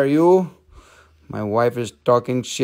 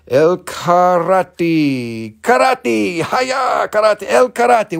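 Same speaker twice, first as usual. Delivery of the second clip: mock karate shout; 4 semitones higher, much slower than usual. Joking name chant.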